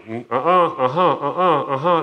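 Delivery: lightly voiced kids bickering, half laughing; mock schoolyard squabble. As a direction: play a playground back-and-forth. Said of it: "like a schoolyard fight".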